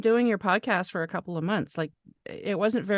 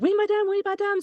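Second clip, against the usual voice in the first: French guest voice; 12 semitones higher than usual. Retold or imitated. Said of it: "And then they'll say".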